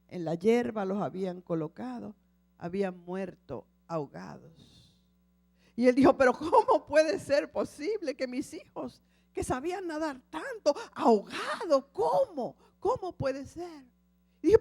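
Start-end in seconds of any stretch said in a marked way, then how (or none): 6.2-13.8 louder, higher cries of disbelief; grieving father questioning; anguished disbelief. Acted. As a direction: cry out why in horror. Father sees drowned children.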